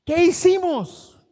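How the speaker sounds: high, loud and slow: what have we done; anguished cry. Acted. Preacher voicing Israel realizing its mistake, a loud slow anguished cry. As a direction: cry it out in dismay, dragging each word.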